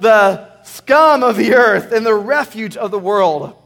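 loud, slow, weighty. Shown as a demonstration. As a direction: proclaim it slowly to the room. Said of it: "let's say it out loud".

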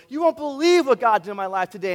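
loud, excited testimony; acted gushing. Preacher imitating a believer telling her friends, gushing. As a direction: burst out with the news, loudly.

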